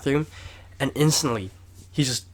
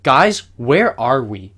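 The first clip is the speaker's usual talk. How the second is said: slow confused; 8 dB louder, much slower than usual. Retold or imitated. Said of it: "He's like".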